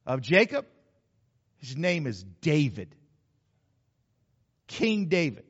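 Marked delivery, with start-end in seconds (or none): none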